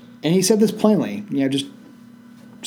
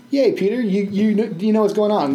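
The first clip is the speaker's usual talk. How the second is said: much higher, gushing fake praise; mock cheer; 7 semitones higher than usual. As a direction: cheer Peter on sarcastically.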